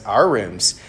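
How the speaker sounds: dry, firm little interjection; bickering correction. Acted. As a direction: calmly correct "rooms" to shared ownership. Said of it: "they're bickering with each other".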